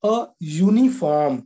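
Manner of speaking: slow, deliberate example phrase; slow demo reading. Shown as a demonstration. Teacher slowly reading the example aloud. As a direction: read the example slowly for students. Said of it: "पहला उदाहरण देखिए".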